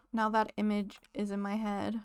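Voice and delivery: ordinary talk, nothing marked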